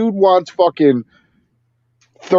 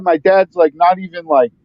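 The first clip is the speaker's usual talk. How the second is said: ordinary talk, nothing marked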